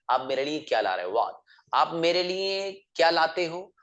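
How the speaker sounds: usual speaking voice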